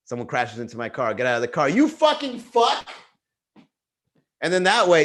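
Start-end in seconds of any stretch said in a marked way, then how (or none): 1.7-2.9 high, slow, loud mock yell; hammed-up yell at the other driver; pretend road rage yell. After someone crashes into his car, a hammed-up slow loud yell.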